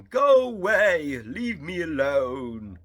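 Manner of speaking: high, very loud drawn-out shout, hammed-up; Colin Clive. Henry shouting back at visitors from his window, hammed-up impersonated yell. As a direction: bellow it slowly as a bad movie impersonation. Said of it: "he starts shouting back at them".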